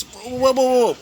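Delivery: high, startled protest; alarmed girl waving off a threat, startled and high; startled whoa. Acted. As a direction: back off fast, hands up.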